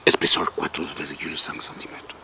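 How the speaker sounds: fast, low repeat with little voicing; rapid repeat. Reciter repeating a catalogue thickness measurement, rapid and low. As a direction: rattle it off low and quick.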